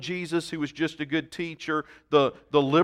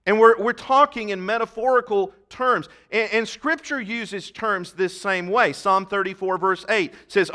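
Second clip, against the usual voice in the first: impassioned preaching; 3 semitones higher, 3 dB louder than usual. Real, not acted. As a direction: preach it to the pews with fire. Preaching scripture at raised pitch.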